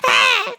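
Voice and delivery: very high mock child screech; imitated tantrum scream. Child rejecting each color a parent offers, mock screech. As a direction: shriek a refusal like a fussy child.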